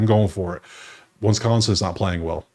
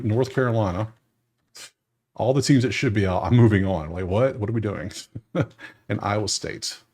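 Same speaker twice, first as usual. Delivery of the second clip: normal talking voice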